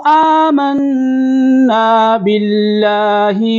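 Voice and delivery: slow, high melodic recitation of ختم اللہ علی قلوبہم; model recitation. Shown as a demonstration. Teacher recites so students can listen carefully, slow melodic chant. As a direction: chant it slowly for students to copy. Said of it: "اب ملا کے پڑھیں گے".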